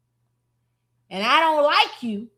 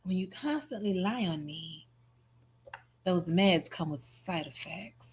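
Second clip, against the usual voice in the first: quiet mocking echo; 7 semitones lower, 10 dB quieter than usual. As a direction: echo it back under your breath, unimpressed. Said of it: "Y'all saw that face?".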